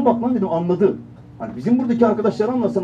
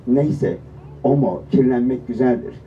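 ad mother resigned; 7 semitones lower, slower than usual. Acted. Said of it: "Bak annesindeki tepki şu".

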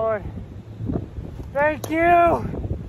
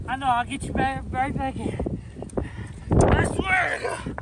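ordinary talk, nothing marked

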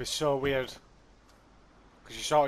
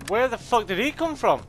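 high, loud startled outburst; 9 semitones higher, 6 dB louder than usual. Real, not acted. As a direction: caught off guard, blurting it out. Sudden enemy appears in game.

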